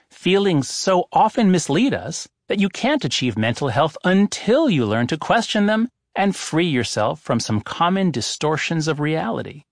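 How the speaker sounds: ordinary delivery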